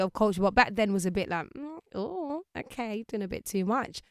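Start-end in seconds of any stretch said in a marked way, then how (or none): 1.5-4.0 soft, high, hesitant judgement; understated mock unease; mock disapproval. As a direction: act politely put off, starting high and quiet. Imagined disapproving reaction back then.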